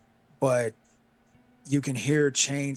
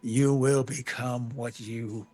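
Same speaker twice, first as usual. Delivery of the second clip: Death; 2 semitones lower, slower than usual. Acted. Game master voices Death in a nightmare, slow and ominous. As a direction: intone it slowly as a sinister figure. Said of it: "Death is just laughing. He's like".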